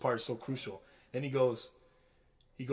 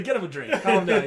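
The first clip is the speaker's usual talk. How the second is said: laughing imitation; 5 semitones higher, 10 dB louder than usual. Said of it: "He's like".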